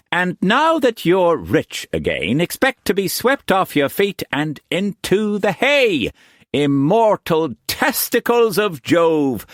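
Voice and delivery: high, gushing flamboyant painter; expansive Quantz. A narrator's reading. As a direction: gush with delight, pitched high. Quantz voiced in a novel.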